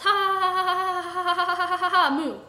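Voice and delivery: long, high, loud cackle; extended evil ha-ha-ha; villainous laugh. A narrator's reading. Villain cackles after threat.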